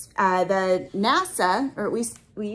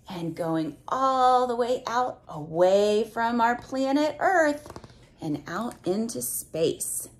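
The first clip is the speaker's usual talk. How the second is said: ordinary delivery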